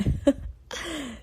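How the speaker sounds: quick laugh at her own slip; embarrassed laugh. Real, not acted. Correcting her show's name: embarrassed laugh. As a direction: laugh off the mix-up.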